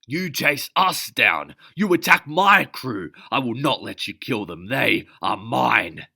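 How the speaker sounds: loud, high and slow, each line hammered out; captain roaring at the soldier; furious yelling. Acted. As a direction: defend your crew at full voice. Captain screams back in fight.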